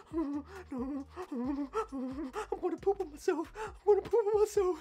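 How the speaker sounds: high mock-crying wail; imitated frantic panic. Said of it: "Like, I'm getting frantic".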